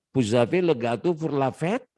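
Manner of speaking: French question rising on "fête"; question intonation. Shown as a demonstration. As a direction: lift "fête" at the end as a question. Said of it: "intonasinya itu intonasi kalimat tanya".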